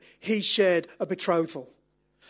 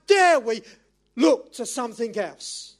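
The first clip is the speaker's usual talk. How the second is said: raised, heated pulpit challenge; getting excited; 3 semitones higher, 9 dB louder, slower than usual. Real, not acted. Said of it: "I'm sorry I'm getting excited now".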